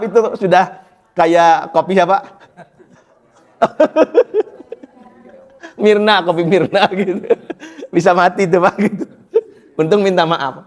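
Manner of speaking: talking through giggles; laughing. Real, not acted. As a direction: chuckle through the joke. Laughing through Mirna joke.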